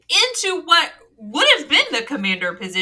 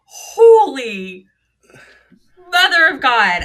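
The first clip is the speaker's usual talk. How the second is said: loud exclamation; 6 dB louder, slower than usual. Real, not acted. Recalling ride intensity.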